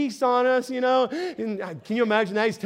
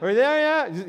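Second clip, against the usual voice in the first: put-on kid on a road trip. Kids nagging dad on a road trip, whiny. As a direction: nag like a bored kid in the car. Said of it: "classic kid question".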